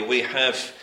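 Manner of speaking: ordinary delivery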